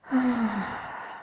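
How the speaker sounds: long falling sigh; heavy exhale before answering; weary sigh. Acted. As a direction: let out a long, put-upon sigh. Witch dreads a grim subject.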